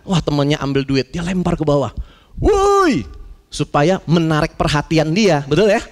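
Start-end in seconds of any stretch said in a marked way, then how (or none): 2.4-3.0 woi tinggi dan keras; teriakan woi. Retold or imitated. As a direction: panggil keras ke bawah. Memanggil teman di bawah. (tukang di atas memanggil teman yang pakai earphone, berteriak)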